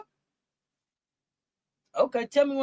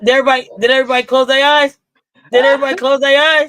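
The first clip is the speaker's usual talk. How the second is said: loud showman call; 3 semitones higher, 9 dB louder than usual. Pretend. Host redoes the bit, shouting it.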